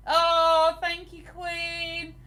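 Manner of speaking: loud, high "oh" and drawn-out thanks; delighted thanks. Real, not acted. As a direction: gush gratefully at a viewer.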